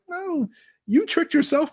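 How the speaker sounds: high, slow, drawn-out retort; mock scolding. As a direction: set a whining fan straight. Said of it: "And it's like".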